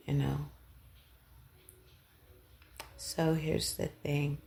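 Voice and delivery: usual speaking voice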